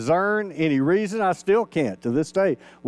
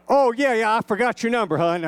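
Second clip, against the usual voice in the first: mother's voice; 5 semitones higher than usual. Retold or imitated. Son calls mom about missed birthday, her higher sheepish excuse. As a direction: play the embarrassed mom, brushing it off.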